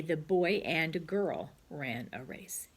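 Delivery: slow, careful dictation pace; slow model sentence. Shown as a demonstration. Teacher dictating the model sentence slowly. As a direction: read it out slowly for the class to copy. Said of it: "Or you could say".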